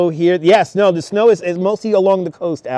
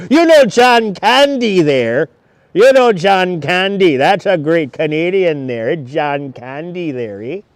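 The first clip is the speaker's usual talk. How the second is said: slow, drawn-out mock Canadian accent. Livestreamer raving about John Candy, in a hammed-up Canadian accent. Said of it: "our favorite Canadians".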